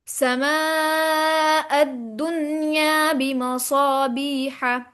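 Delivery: slow chanted tajweed demo; sustained, measured chant; Quranic recitation. Shown as a demonstration. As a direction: recite slowly with long, level vowels.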